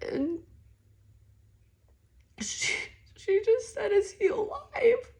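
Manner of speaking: high, breaking tearful voice; fighting back sobs; crying. Real, not acted.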